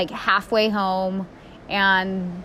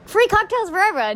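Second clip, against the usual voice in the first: much higher voice, joking pilot promise; playful fake captain announcement; mock peppy captain; 12 semitones higher than usual. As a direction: ham up a giddy captain. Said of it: "No, he didn't say that".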